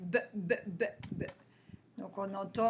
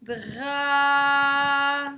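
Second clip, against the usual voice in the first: "dra" long, high and loud; held dra; 4 semitones higher, 8 dB louder than usual. Shown as a demonstration. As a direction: hold out "dra". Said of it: "D, dr".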